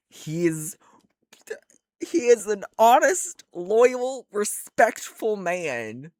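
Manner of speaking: high, loud, swooning praise; gushing. Real, not acted. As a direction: gush over him, high and loud.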